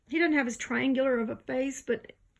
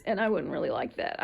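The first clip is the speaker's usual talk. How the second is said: normal talking voice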